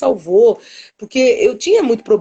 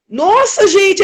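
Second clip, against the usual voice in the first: astonished exclamation; 9 semitones higher, 4 dB louder, much slower than usual. Retold or imitated. Meeting a woman like grandma.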